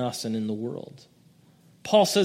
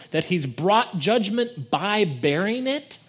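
ordinary talk, nothing marked

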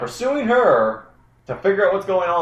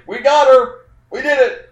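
gleeful guards; 11 semitones higher, 4 dB louder than usual. Acted. Guards celebrating capture.

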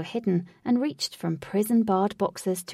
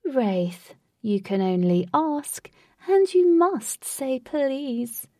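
lightly voiced theatrical host; proud showman tour guide; grand host voice; 5 semitones higher, 4 dB louder, much slower than usual. Lutz scolding, slow.

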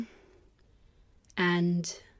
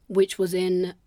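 normal talking voice

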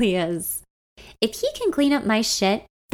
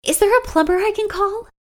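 desperate; 12 semitones higher than usual. Acted. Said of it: "desperate to fix the situation".

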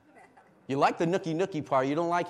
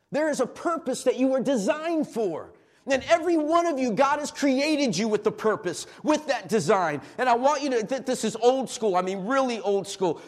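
higher, louder preaching with punch; fervent pulpit delivery; rising preaching; 8 semitones higher, 7 dB louder than usual. Acted. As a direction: press the point on the congregation.

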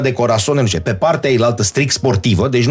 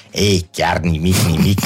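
imitating the coach; 6 semitones lower, slower than usual.

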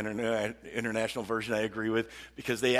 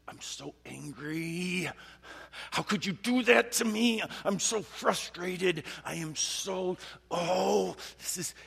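acted anger; 6 semitones higher, slower than usual. Pastor acts out David's anger at being wronged, frustrated outburst. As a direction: act out someone venting their fury. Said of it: "this is not just trembling in fear".

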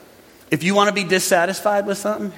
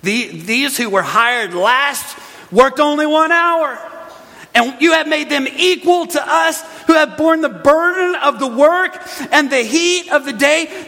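indignant workers; 6 semitones higher than usual. Acted. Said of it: "Look at this in verse number twelve".

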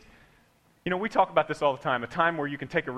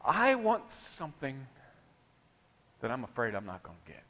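soft admission; 5 semitones lower, 7 dB quieter than usual. Shown as a demonstration. Preacher shows the quiet follow-up, softly. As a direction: drop down and admit it gently. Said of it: "Even if you have to yell it".

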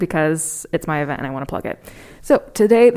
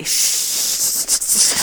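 librarian shush. Retold or imitated. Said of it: "we say".

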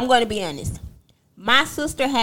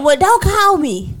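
high, drawn-out sassy retort; indignant comeback; 8 semitones higher, 3 dB louder than usual. Retold or imitated. Talking back to her sister on the phone, indignant. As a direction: snap back at her, pitched up and stretched.

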